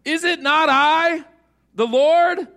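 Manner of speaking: slow, loud, high, thundering voice of the Lord; God thundering at a doubting Moses; booming God. Acted. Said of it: "Then the Lord said to him".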